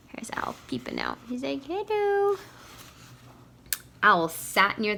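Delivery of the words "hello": high, timid call; high owl voice. Pretend. Owl peeping out calling.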